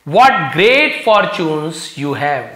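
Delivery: very slow, drawn-out praise; slow reading. Shown as a demonstration. Teacher voicing the fortune teller flattering Karim, very slow. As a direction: stretch every word of the compliment.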